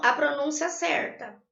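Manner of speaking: ordinary delivery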